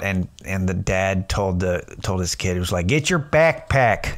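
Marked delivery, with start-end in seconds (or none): 2.9-4.1 put-on higher, slow Chicago accent; imitated local accent. Chicago dad telling his kid to fetch a bag, broad local accent. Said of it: "it's the accent, bro".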